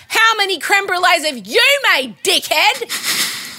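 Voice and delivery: high-pitched indignant yell, no real volume; mock yell. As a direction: re-enact screaming at the telly. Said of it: "And I yelled".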